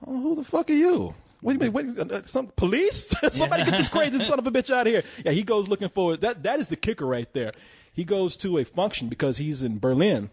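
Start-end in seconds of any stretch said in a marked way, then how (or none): 0.0-5.0 much higher, indignant; high mock outrage; mock outraged stranger. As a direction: act insulted by a stranger. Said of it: "everybody's kinda like".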